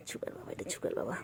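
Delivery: soft whisper to a pet. Real, not acted. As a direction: hush it in a breathy whisper. Whispering near sleeping pet. (ফিসফিস করে)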